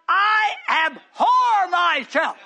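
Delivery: slow loud anguished cry on abhor; cried out. Retold or imitated. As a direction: drag out abhor in despair. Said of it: "he cried out".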